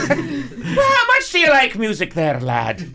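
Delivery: mock creepy old man. Joking with a friend, as a hammed-up creepy old man. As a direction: play a sleazy stranger luring a boy.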